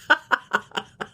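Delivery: amused high burst of laughter; real laugh. Real, not acted. Recapper amused by the petty appointment, laughing. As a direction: crack up at your own story.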